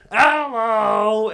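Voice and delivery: loud, high haunt-actor holler; big, drawn-out scare yell; jump-scare shout. Retold or imitated. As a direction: burst out to startle them. Re-enacts popping out to scare.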